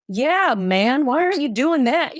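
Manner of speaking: high, lightly whining protest; mock complaint. Hopeless romantic to husband during movies, mock complaint. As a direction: playfully nag a partner.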